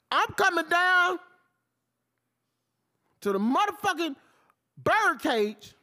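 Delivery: loud slow warning to the coach; loud threat. Real, not acted. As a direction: shout it like a promise.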